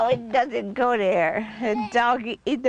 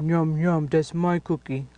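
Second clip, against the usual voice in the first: low gruff toy dog; doggy voice; 6 semitones lower than usual. Pretend. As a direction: growl it like a grumpy puppy.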